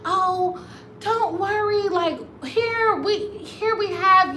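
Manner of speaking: high, sweet reassuring voice; high gentle don't worry; kind American couple. Retold or imitated. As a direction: soothe her in a high, sweet voice. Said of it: "they were like".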